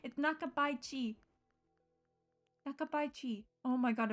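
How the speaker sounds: usual speaking voice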